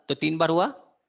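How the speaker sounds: ordinary talk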